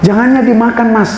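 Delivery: high-pitched eager villager warning; high, insistent village voice; excited local. Acted. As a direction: warn him urgently in a high voice. Villager urging guest to eat.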